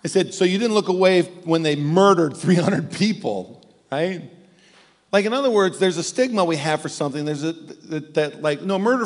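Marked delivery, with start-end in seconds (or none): 0.3-4.3 pointed teasing question, louder; mock incredulous. Pastor ribbing his friend over the movie, mock incredulous. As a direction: rib him with a sly louder challenge.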